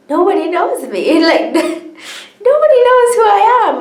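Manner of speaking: high, loud mock disbelief breaking into laughter; acted-out astonished outburst; incredulous outburst. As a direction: squeal it in laughing disbelief, voice shooting up. Acts out disbelief at being cheered.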